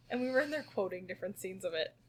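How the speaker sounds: ordinary talk, nothing marked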